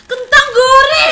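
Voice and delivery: laughing shout. Real, not acted.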